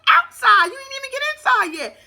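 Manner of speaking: high, loud disbelieving scold; mock exasperated yell. Host tells a crying bride to calm down, loud mock scold. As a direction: snap at her to get a grip. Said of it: "I said".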